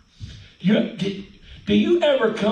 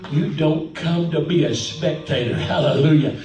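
rhythmic, pitch climbing higher as it goes; fervent preaching; 4 semitones higher than usual. Real, not acted. Preacher exhorting church not to be spectators, rising fervent preaching. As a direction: drive each phrase home, punctuated by breaths.

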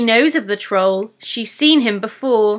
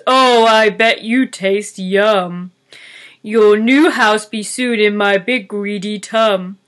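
greedy troll; 4 dB louder than usual. Acted. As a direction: savour the threat like a hungry troll. Troll voice held.

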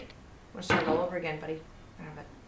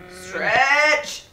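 loud, higher, drawn-out push; straining effort; 4 semitones higher, 8 dB louder than usual. Real, not acted. Player willing the climber upward, loud straining cry. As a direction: strain the word out as you reach.

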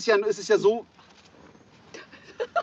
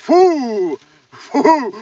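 disgusted puffing; 7 semitones higher, 10 dB louder, much slower than usual. Real, not acted. Reacting to stench.

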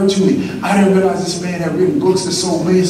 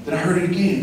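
ordinary talk, nothing marked

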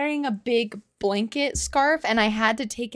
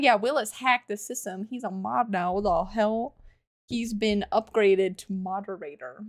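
ordinary delivery

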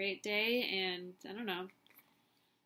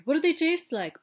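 higher, louder mocking imitation; mocking the duckling; 8 semitones higher, 12 dB louder, faster than usual. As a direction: throw his question back at him. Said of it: "Blah, blah, blah".

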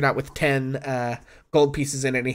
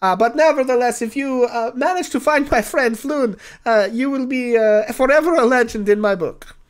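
higher, lilting non-player character voice; polite, doubtful client; 10 semitones higher, 5 dB louder than usual. Acted. Client tells boastful bard he's never heard his legends, politely sceptical. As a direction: let the doubt show courteously, amused.

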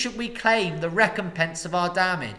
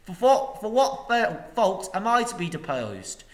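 loud, high, ringing oratory; very loud and clear; 8 semitones higher, 8 dB louder than usual. Acted. The master rises and harangues the people, loud and clear. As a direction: rise and harangue the crowd. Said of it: "spoke very loud and clear".

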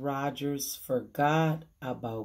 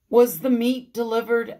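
louder, higher demanding question; raised voice, a touch urgent; anxious questioning; 5 semitones higher, 7 dB louder than usual. A narrator's reading. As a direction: lightly act a worried employer checking up. Mrs Rogers anxiously checking on meat.

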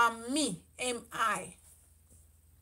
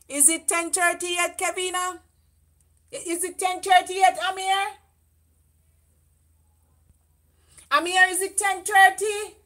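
calling out; 5 semitones higher, 4 dB louder than usual. Real, not acted. Calling to family across house.

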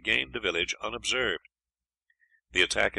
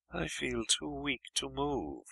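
slow, soft, higher weak voice; barely able to speak from weakness; exhausted invalid, quiet and drawn out; languidly; 7 semitones higher, 6 dB quieter, slower than usual. A narrator's reading. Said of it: "Percy replied languidly".

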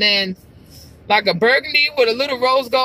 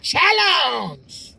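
movie guy's "challenge"; 5 semitones higher than usual. Retold or imitated. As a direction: belt "challenge" like a movie trailer voice. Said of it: "The guy was like".

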